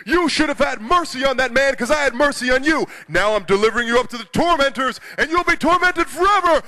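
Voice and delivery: loud, high, hammed-up royal condemnation; wrathful king. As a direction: pass sentence as a furious king, rising to a high peak. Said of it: "the king says".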